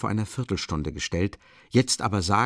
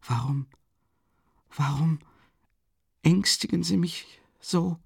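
put-on high, childlike pleading that climbs; childlike, smiling; 9 semitones higher than usual. A narrator's reading. Sonja pleads with Raskolnikov, high and childlike, smiling through fear. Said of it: "wie ein Kind lächelnd".

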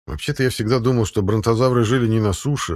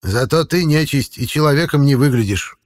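smirking retort; 6 semitones higher, 4 dB louder than usual. Acted. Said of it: "парировал дед".

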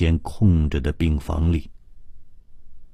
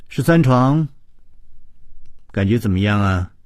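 routine nurse greeting; 6 semitones higher, 4 dB louder than usual. A narrator's reading. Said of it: "例行公事似的说".

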